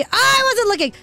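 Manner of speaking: sudden high yelp of alarm; real gamer's panic; startled outcry. Real, not acted. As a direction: blurt it high, caught off guard mid-sentence. Caught off guard in the game.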